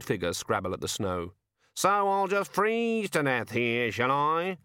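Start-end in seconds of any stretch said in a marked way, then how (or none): 1.8-4.6 higher, slow self-pitying whine; gloomy raven. Acted. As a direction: mope like a doomed bird. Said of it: "it said gloomily".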